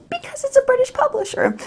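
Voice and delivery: very high-pitched comic answer; sky-high joking voice; mock explanation. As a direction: answer yourself in a tiny, very high voice. Joking answer to her own question.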